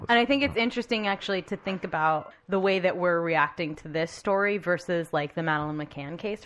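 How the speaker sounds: normal talking voice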